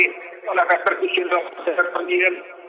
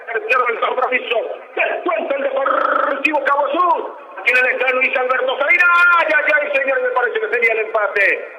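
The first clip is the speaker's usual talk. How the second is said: excited goal commentary; 5 semitones higher than usual. Real, not acted. Live goal commentary continues.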